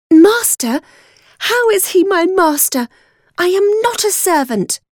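high-pitched indignant outcry; crying out. A narrator's reading. Girl protests to the maid, crying out indignantly. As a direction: protest sharply, pitch leaping well up. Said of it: "I cried".

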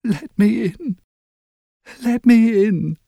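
high, thin ghostly plea from outside the window; a child ghost begging to come in; sad little ghost voice. A narrator's reading. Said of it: "I heard a sad little voice".

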